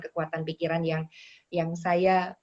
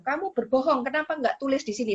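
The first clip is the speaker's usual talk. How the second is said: higher, mock scolding customs officer; mock angry accusation, higher pitched; imitating angry officer; 6 semitones higher than usual. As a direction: scold like the strict old customs lady, higher. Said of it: "Oh, dia marah-marah ke saya".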